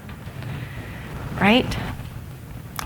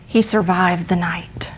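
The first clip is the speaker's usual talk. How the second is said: hushed whisper; 4 semitones lower, 6 dB quieter than usual. A narrator's reading. Dramatic turn in told story.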